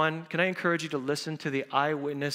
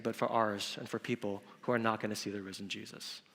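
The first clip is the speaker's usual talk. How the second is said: ordinary talk, nothing marked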